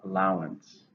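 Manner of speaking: "Allowance" stressed on LOW, falling; stress on second syllable. Shown as a demonstration. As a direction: model "Allowance" as al-LOW-ance. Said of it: "Stress is on the second".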